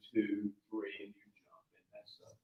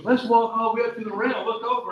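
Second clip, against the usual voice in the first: higher, louder eager wife voice; imitating wife; 8 semitones higher, 6 dB louder than usual. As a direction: play the wife urging him to the edge. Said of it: "she goes".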